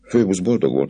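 ordinary delivery

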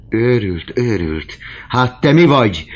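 loud, high angry comeback; outraged exclamation; indignant retort. A narrator's reading. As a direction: throw the insult back, rising in force. Countess retorts in a quarrel.

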